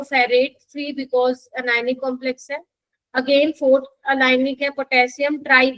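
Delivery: slow, spelled-out dictation pace. Shown as a demonstration. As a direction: dictate each name slowly for notes. Slow dictation of compound names.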